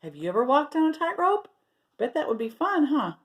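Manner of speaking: ordinary delivery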